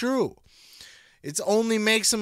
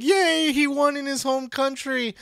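mock cheer; 8 semitones higher than usual. Mock cheering crowd quote.

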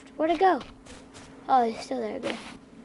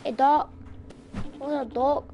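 ordinary talk

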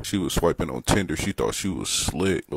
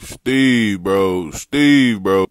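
yelling at the turtle; 3 semitones higher, 10 dB louder, much slower than usual. Real, not acted. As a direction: holler Steve like calling a friend across the street. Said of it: "I'm not gonna stop yelling at you".

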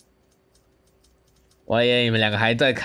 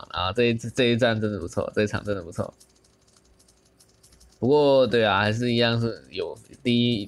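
ordinary delivery

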